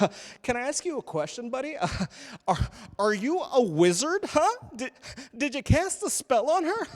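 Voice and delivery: high-pitched goofy heckler; mocking, high-voiced buddy act; joking wedding guest. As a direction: needle him like a pleased-with-himself joker. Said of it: "Guys are making bad jokes at my wedding".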